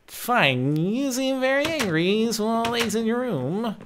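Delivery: high, drawn-out mock gangster voice; mad gangster. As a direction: parody an old movie gangster. Keeps mock gangster voice replying.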